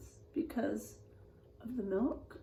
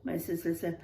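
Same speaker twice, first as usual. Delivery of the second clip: expressive storybook voice, quotes acted out; reading to children; 5 dB louder than usual. A narrator's reading. Teacher reading a spooky picture book to the class, expressive. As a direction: read it like a spooky bedtime tale for kids.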